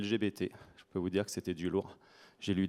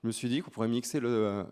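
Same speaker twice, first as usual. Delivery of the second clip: usual speaking voice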